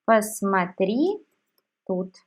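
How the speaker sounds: ordinary delivery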